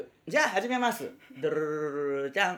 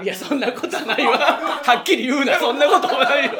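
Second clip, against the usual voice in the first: louder and higher, laughing through the denial; laughing protest; 6 semitones higher, 7 dB louder than usual. Real, not acted. Laughing at a teasing partner, protesting. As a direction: protest while cracking up.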